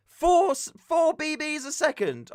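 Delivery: high, slow mock answer; prissy official voice; marshal. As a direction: marshal giving a rule. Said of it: "And they said".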